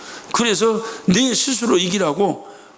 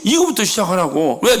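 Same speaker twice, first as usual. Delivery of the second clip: ordinary delivery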